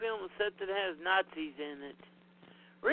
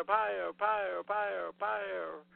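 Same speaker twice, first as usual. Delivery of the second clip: trailer echo; 3 dB quieter, much slower than usual. Pretend. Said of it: "Should I say a couple more times?".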